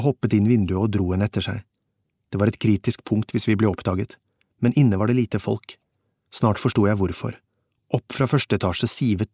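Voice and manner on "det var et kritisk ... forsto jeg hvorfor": ordinary delivery, nothing marked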